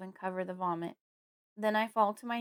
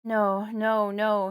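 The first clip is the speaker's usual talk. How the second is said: grieving father, louder; moaning; 9 dB louder, much slower than usual. A narrator's reading. Father rocking his dead wife, moaning. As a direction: moan the words in despair. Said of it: "he moans".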